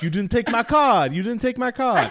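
high, loud mock of a pushy stranger; greasy Italian man. Retelling the driver shouting at her car, laughing. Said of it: "he's like".

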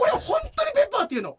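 high-pitched, emphatic protest; indignant insistence. Real, not acted. Host defending his anecdote to co-host, high indignant insistence. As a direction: insist at the top of the voice range.

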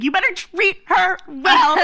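high, drawn-out teasing warning; mock lecture. Girls lecturing a guy in line, high and teasing. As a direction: wag a finger at him playfully. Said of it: "We were like".

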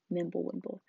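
usual speaking voice

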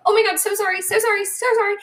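high, rushed string of sorries; flustered over-apologizing woman. Retold or imitated. Host mimicking women apologizing in a crowd, high and flustered. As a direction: gush apologies like you bumped someone in a crowd. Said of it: "I don't see very many men saying".

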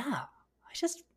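hushed confession; whisper. Real, not acted. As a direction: drop to a secret whisper.

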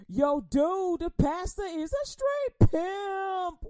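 loud, high, slow street-guy drawl. Retold or imitated. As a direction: play the mocking guy from the street. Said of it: "they go".

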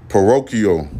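parochial said carefully, stressed on the ro; parochial, pronounced deliberately; careful word. Shown as a demonstration. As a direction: sound out parochial as puh-ROH-kee-ul. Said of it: "that's how you say it".

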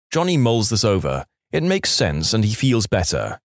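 ordinary talk

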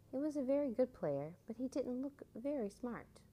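ordinary delivery

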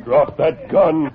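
slow, loud command; shouted order. Acted. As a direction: bark the order. Hero grapples with crook.

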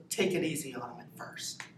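hushed conspiratorial whisper; whispered advice. Retold or imitated. Marine father to daughter at the bus, whispering. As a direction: lean in and whisper it like a secret.

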